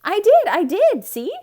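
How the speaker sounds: high, loud proud reply; eager. A narrator's reading. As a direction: insist brightly, showing off. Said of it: "said Amelia Bedelia".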